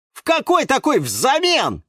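astonished carnivorous plant; surprised. A narrator's reading. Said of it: "удивился".

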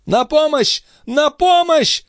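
very high, urgent cry; panicked high-pitched outcry; screaming for help. A narrator's reading. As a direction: call out in desperate alarm. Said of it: "закричала Андреа".

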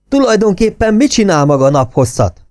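high, loud furious officer's yell; shouting angrily. A narrator's reading. Furious officer yelling at a subordinate officer. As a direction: roar it at him in fury. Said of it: "Bug ordított".

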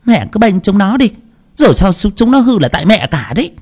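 lightly voiced bitter young mother; resentful daughter-in-law. A narrator's reading. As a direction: complain to the mother-in-law who spoils the kids. Daughter-in-law snapping back.

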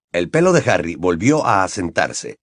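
usual speaking voice

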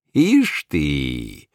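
low, smug grin in the voice; a self-satisfied smirk of a workman; smug low chuckle-voice. A narrator's reading. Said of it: "самодовольно ухмыльнулся".

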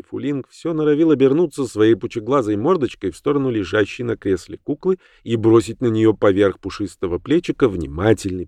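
plain reading voice

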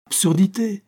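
sharp high exclamation of disbelief; indignant outburst. Acted. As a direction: cut her off in indignation. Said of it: "interrompit Shirley".

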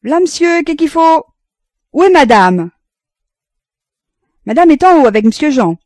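bonne répondant de loin, aiguë et forte; voix de la bonne. A narrator's reading. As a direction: lancer la réponse depuis le sous-sol. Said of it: "La voix de la bonne sortit des profondeurs". (la bonne répond d'en bas au maître, criée)

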